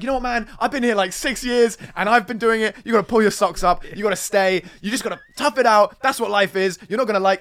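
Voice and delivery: hammed-up irritated mate telling him to stay; mock angry friend. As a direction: scold him to toughen up. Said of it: "he gets pissed at me and he's like".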